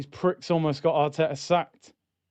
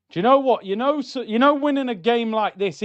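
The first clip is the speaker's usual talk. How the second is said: usual speaking voice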